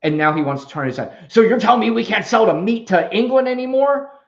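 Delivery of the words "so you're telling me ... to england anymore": louder, higher mock outrage; mock indignant objection. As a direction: act out someone incredulously objecting.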